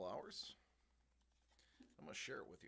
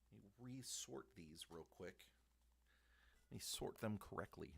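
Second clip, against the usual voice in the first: ordinary talk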